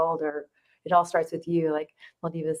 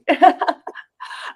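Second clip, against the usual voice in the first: giddy laugh; 12 semitones higher, 7 dB louder than usual. Real, not acted. Giddy laugh after greeting.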